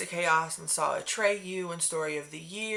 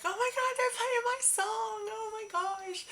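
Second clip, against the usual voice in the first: very high excited shriek of joy; excited squeal. Retold or imitated. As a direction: squeal like a fan hearing her song. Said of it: "I'm, like, yelling".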